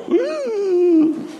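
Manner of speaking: high squeaky startled ooh; a little dragon jumps at a mouse; sudden high frightened squeal. Acted. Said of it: "and Sally goes".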